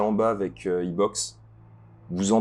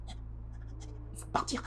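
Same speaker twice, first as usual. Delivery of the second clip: hushed, urgent, slow whisper; frightened whisper; 15 dB quieter, much slower than usual. Acted. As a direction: whisper the warning in fear. Frightened NPC urging escape.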